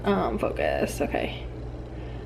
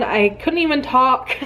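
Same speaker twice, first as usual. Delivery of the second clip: usual speaking voice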